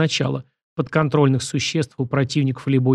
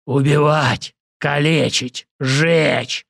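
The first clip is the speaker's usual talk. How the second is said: far-off berserker voice; battle roar; much slower than usual. Acted. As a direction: roar it like a crazed fighter. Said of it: "взревел противник".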